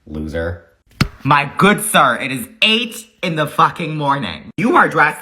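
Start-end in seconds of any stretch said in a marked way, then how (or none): 0.1-0.6 sneering loser. Retold or imitated. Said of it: "This man had the audacity to look at me and say".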